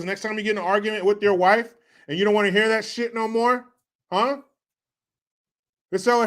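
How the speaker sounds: ordinary talk, nothing marked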